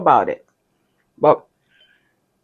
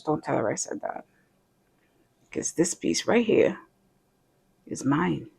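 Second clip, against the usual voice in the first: hushed aside; 11 dB quieter than usual. Real, not acted. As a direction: confide it under your breath. Secret aside about sister.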